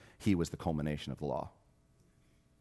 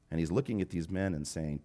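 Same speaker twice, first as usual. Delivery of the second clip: ordinary talk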